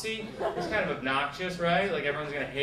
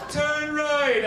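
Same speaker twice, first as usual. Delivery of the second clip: loud, high bossy command; overbearing navigator; 11 semitones higher, 7 dB louder than usual. Retold or imitated. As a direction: imitate a domineering girlfriend as sat-nav. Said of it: "like having an overbearing girlfriend".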